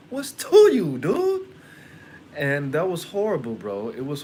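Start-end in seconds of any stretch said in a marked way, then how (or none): 0.1-1.5 sudden outburst. Retold or imitated. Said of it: "And I was like".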